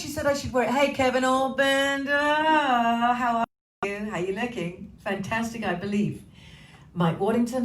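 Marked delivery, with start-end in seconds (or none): 0.7-4.9 drawn-out sing-song greeting. Acted. Greeting a viewer in a put-on voice.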